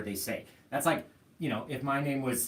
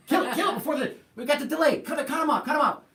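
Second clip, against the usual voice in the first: much higher, louder panicked orders; mock panicked shouting; 14 semitones higher, 7 dB louder, faster than usual. Painter imagines ordering co-host to cut the cameras, panicked shout. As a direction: panic to kill the cameras, laughing. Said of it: "I'll be like".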